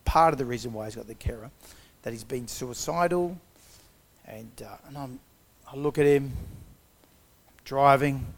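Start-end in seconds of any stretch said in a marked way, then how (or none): none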